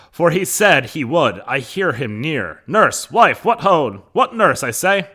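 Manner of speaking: loud, high stage calling. Acted. Capulet calling for wife and nurse.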